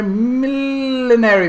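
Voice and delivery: drawn out slow and high, millinery; savoured word. Shown as a demonstration. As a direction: stretch millinery out slowly, relishing each syllable. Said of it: "saying millinery over and over".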